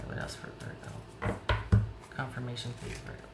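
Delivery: barely-there whisper while shuffling. Real, not acted. Reader whispers the question to herself while shuffling, in a whisper. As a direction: whisper to yourself over the cards.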